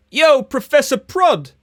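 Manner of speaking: high, singsong heckle; hammed-up slangy kid calling out in class; mock street-kid student. Student heckling the new teacher from the back, mock street-kid voice. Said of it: "at the back of the class, you hear".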